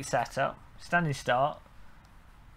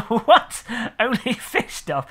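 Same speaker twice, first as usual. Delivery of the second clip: higher, drawn-out baffled question; laughing disbelief; 6 semitones higher, 4 dB louder, slower than usual. Real, not acted. Streamer questioning a viewer's odd chat message, amused disbelief. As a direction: repeat it as if you can't believe it.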